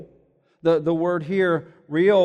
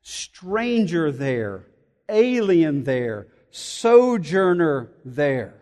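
slow, weighty meaning; 2 semitones lower, much slower than usual. Shown as a demonstration. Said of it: "the name, uh, meant".

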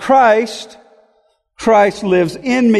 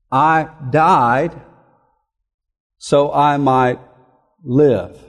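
each phrase set apart by long pauses, lower; slow emphatic reading; 6 semitones lower, slower than usual. Shown as a demonstration. Preacher draws out the contrast in the verse, slow and weighty. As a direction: dwell on died and live, pausing between. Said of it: "Do you notice the language?".

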